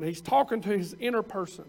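normal talking voice

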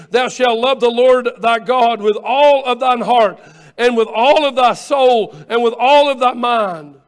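loud, ringing scripture proclamation; preaching at full force. Acted. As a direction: lift the verse up to the congregation. Preaching the great commandment.

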